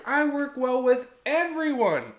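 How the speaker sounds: high-pitched, mock-keen intern claim; overeager intern. Intern telling supervisors they can treat any client, mock-eager. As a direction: imitate an intern's sweeping promise, pitched well up. Acted intern boasting of working with anyone.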